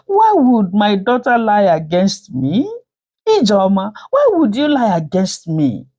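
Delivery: high, wailing protest; fake tearful. A narrator's reading. Stepfather accusing daughter before everyone, with fake tears. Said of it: "with fake tears and he said".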